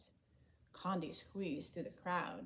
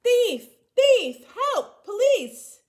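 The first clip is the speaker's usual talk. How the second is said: very loud, much higher cries for help; alarmed shouting; 19 dB louder, much slower than usual. A narrator's reading. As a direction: scream it to raise the whole market. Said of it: "Condi heard a voice call".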